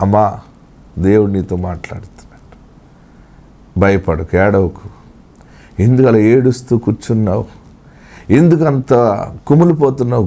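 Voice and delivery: hushed prophetic consolation; prophetic word. Acted. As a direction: speak for God, low and close. God speaking to the listener.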